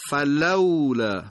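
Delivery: فلولا slow, with the silent waw clear; careful slow فلولا; silent waw demo. Shown as a demonstration. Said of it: "نحوهٔ خواندن اون واوی که ساکن".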